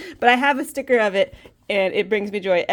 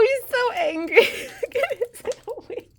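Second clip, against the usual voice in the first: much higher voice, giggling through the words; laughing and gushing; 11 semitones higher than usual. Real, not acted. Admiring the dragon's little wings, laughing. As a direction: coo at the plushie, laughing.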